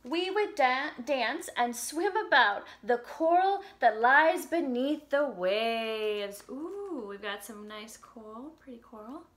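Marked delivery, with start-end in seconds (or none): 0.0-6.4 slow, sing-song storybook reading; reading to children. Acted. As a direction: read the lyrics gently to little kids. Reads song lyrics to children.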